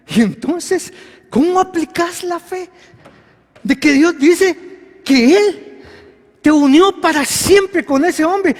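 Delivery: full-force preaching. Acted. Preacher challenging a believer's marriage, full force. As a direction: drive the question home.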